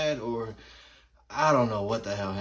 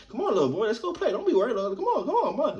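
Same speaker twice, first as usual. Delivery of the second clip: put-on high giggly girl coaxing; pushy little girl, laughing; 10 semitones higher, 3 dB louder, faster than usual. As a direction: coax him with a laugh. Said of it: "she was very like".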